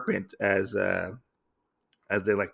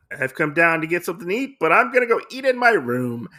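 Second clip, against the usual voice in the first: huffy acted retort, higher pitched; angry; 6 semitones higher, 3 dB louder, faster than usual. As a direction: storm off with a raised-pitch retort. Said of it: "He gets very angry at this point".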